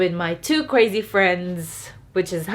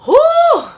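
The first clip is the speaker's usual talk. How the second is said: high, loud laugh bursting into a whoo; tearful laugh and whoo; 10 dB louder than usual. Real, not acted. Laughing off her tears.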